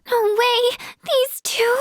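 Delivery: high-pitched, incredulous; shocked disbelief. Acted. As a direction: blurt it out in surprise. Disbelief at a discovery.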